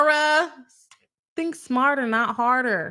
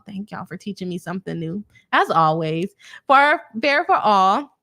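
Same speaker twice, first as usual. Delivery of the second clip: ordinary talk, nothing marked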